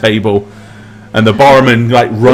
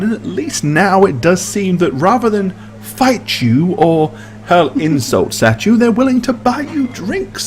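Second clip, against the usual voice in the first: lightly raised, slow dry aristocrat; a hint of a wry advisor; Beecham NPC voice; 7 semitones higher, 6 dB quieter than usual. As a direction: drawl out the compliment with dry wit. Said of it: "He says".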